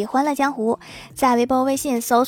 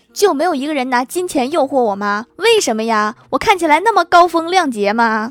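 mock-indignant shout, building higher and louder; sudden loud outburst; 3 semitones higher, 5 dB louder than usual. Brother protesting that nobody tempted him with money, in a sudden loud outburst. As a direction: burst out louder, climbing to a high, comic protest. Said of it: "我哥突然大声说".

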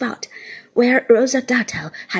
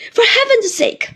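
high, loud urgent plea; alarmed appeal in a raised voice; entreating; 12 semitones higher, 6 dB louder than usual. A narrator's reading. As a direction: beg her to stop. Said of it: "I entreated her".

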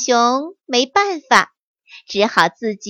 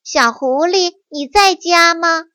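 louder call at the door; 5 semitones higher, 4 dB louder than usual. Acted. Visitor calling for the hungry fox, calling out. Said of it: "有一个声音在喊".

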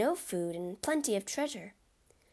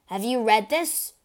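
much louder furious demand; angry shout; 3 semitones higher, 9 dB louder than usual. Acted. Albert storms into his friend's office, shouting in anger. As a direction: wave the paper and shout it.

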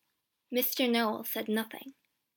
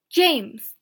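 loud, higher-pitched call; 4 semitones higher, 10 dB louder than usual. A narrator's reading. Mister Noel summons the brother to talk, calling out. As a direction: call the name out. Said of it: "he called".

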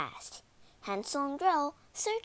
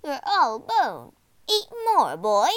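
loud, swooping witch voice; exasperated witch; 3 semitones higher, 8 dB louder than usual. A narrator's reading. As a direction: scold the boy with big swoops. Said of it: "sighed the witch".

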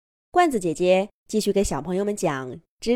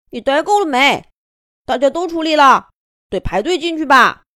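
scolding little bird; 7 semitones higher, 7 dB louder than usual. Acted. Little bird scolding others in line.

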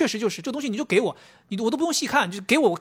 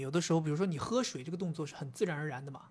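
hushed aside; 3 semitones lower, 8 dB quieter than usual. Real, not acted. Confiding an everyday habit.

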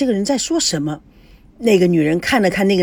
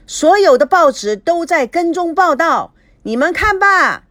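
raised, higher street-vendor cry; loud hawking; 7 semitones higher than usual. A narrator's reading. News vendor hawking to the crowd, loud and high. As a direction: call out headlines to passersby. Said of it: "摊主在旁边大声地叫嚷着".

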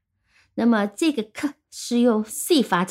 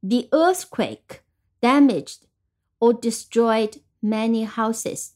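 slow model reading; slower than usual. Shown as a demonstration. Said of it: "再听一句".

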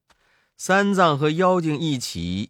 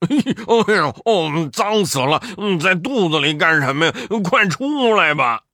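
chuckling, louder and fast teasing; laughing; 4 dB louder than usual. A narrator's reading. Bajie laughs and teases the monkey inside the demon's belly. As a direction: laugh through the teasing words. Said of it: "八戒笑道".

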